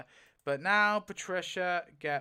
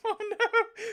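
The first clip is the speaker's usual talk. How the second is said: very high-pitched "oh no" with laughter; dismayed cry; 4 dB louder than usual. Real, not acted. Player sees Judith lose plot armor, dismayed cry.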